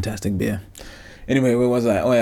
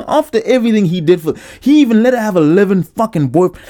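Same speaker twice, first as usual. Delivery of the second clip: high, louder, laughing disbelief; indignant laughing rant, higher and louder; laughing outrage; 9 semitones higher, 5 dB louder than usual. Real, not acted.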